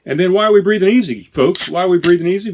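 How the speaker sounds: excited hype. Acted. Rhetorical hype to listeners.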